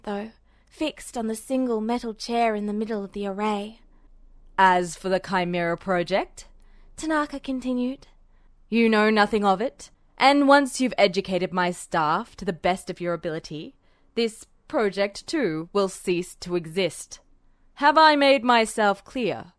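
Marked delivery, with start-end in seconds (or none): 4.6-6.5 low, firm officer's voice; stern general. Acted. General warning Edward off the project, stern. 8.7-19.5 stern general. Acted. General warning Edward to keep silent, stern and threatening.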